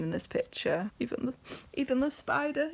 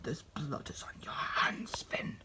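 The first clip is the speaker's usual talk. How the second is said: breathy, slow, threatening whisper; Burn whispers darkly to Finn; menacing whisper; 5 semitones lower, 9 dB quieter, slower than usual. Retold or imitated. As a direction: hiss the accusation low and close. Villain Burn accuses Finn.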